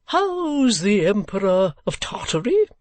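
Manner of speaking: put-on high, slow eccentric old man; old gentleman. Old gentleman on wall asking after an emperor, eccentric and grand.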